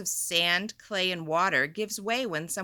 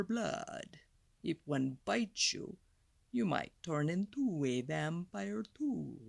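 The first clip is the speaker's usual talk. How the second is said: lightly voiced vampire; 3 semitones lower than usual. Teacher reading the vampire page in a low vampire voice. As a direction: lean into a creepy count.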